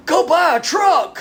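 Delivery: mock voice of God shouting from nowhere; booming inner voice. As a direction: boom out a mock divine order. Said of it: "I hear this voice go".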